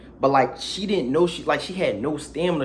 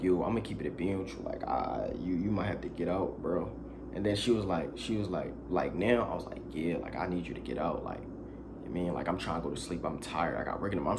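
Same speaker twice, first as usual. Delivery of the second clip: much softer, awkward ask to leave; quiet and hesitant; 9 semitones lower, 10 dB quieter than usual. Retold or imitated. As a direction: tell her to go without raising your voice. Telling her to leave, retold.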